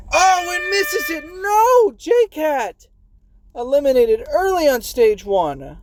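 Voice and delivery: high, loud outburst as the runner misses; shocked commentator shout. Real, not acted. Commentator to viewers as J Cat falls, shocked loud shout. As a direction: yell in dismay at the miss. Commentator sees player miss jump.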